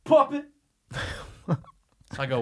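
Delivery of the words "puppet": high, sudden one-word heckle; imitated call-out. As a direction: imitate a stranger calling out from across a lot. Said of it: "this is what I hear".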